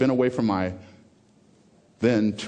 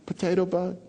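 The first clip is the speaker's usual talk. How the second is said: put-on high little-girl chirp; imitated young daughter; 7 semitones higher than usual. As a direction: mimic a child fixated on one thing. Quotes daughter at dinner.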